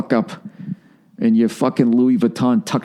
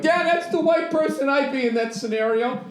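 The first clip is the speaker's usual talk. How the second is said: mock moviegoer; 14 semitones higher, 5 dB quieter than usual. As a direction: coo it like a self-satisfied viewer. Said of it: "be like".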